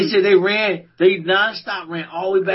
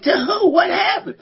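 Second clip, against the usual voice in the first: alarmed; 12 semitones higher than usual. Retold or imitated. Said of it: "She's like".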